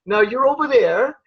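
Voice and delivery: put-on high, sweet English accent, like an angel; angelic English accent. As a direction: play a gentle English angel directing people. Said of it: "an angel with an English accent saying".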